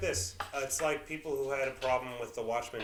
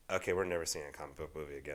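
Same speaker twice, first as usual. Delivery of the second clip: lower, quieter, fast fed-up complaint; voicing girlfriend; 9 semitones lower, 8 dB quieter, faster than usual. Voicing his girlfriend complaining after the movie. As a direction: rattle it off as the annoyed girlfriend.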